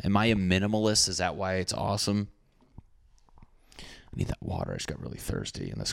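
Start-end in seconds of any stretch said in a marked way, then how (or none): 3.8-5.9 breathy near-silent whisper, much softer; ASMR whisper. Real, not acted. Dry-mouthed podcaster whispering to listeners, ASMR whisper. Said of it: "I got, like, A S M R voice right now".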